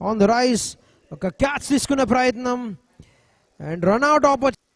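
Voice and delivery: rising, loud, urgent call of the action; excited cricket commentary. Real, not acted. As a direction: shout the near-catch and run out chance. Catch chance, run out call.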